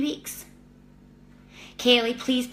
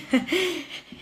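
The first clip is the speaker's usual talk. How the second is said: relieved laugh; 7 semitones higher than usual. Real, not acted. Laughs after reading good news.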